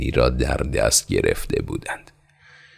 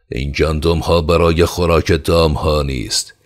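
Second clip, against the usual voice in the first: sharp, raised angry retort; angry shout; 4 semitones higher, 5 dB louder than usual. Acted. Khan angrily shouting at the peasant. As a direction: snap back in anger. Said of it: "خشمگینانه فریاد زد".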